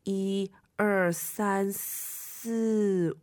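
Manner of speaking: slow, hushed, drawn-out count; suspenseful quiet tally; careful counting. Acted. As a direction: count one by one, stretching the last. Counting the spells on the page.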